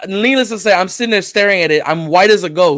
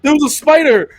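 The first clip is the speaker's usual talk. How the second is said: high, louder scared blurt; panicked reply; 9 semitones higher, 4 dB louder than usual. Retold or imitated. Son answering dad about the spider, high panicked blurt. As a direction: sound freaked out by a spider. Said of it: "I'm like".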